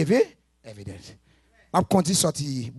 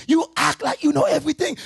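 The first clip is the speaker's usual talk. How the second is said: high mocking put-on voice; mock scolding; 8 semitones higher, 3 dB louder than usual. Preacher mocking a reckless youth, high scolding voice. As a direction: mimic a know-it-all scold, pitched up.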